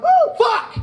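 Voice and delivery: very high loud peacock-like yell; exasperated shout. Retold or imitated. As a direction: shriek it in exasperation. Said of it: "I'm going".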